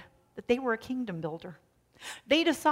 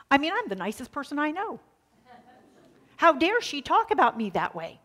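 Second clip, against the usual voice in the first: mock outrage, louder and higher; retold indignation; 7 semitones higher, 8 dB louder than usual. Preacher imitating his own angry thoughts, louder and higher. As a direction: replay the self-righteous fury, half-laughing at yourself. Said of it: "I had worked myself up into a real anger".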